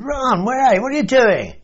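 high call across the road to his brother; calling across the street. Retold or imitated. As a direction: shout over to Ron, surprised.

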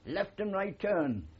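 high, slow mock parade-ground bark; retold drill shout. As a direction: call it out like a sergeant on the square, drawn out. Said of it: "this warrant officer shouted".

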